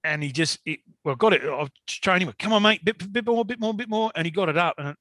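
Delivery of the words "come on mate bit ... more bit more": high, repetitive guiding call; retold coaxing. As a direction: act out directing a driver inch by inch. Guiding the forklift driver bit by bit.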